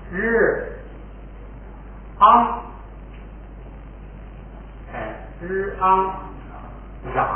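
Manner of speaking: 日 and 昂 said apart, quietly and stretched, then joined as 嚷; teacher showing how 嚷 is really pronounced; sound breakdown demo. Shown as a demonstration. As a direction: split 嚷 into 日 plus 昂, softly, sound by sound. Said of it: "实际上发音发成这样了".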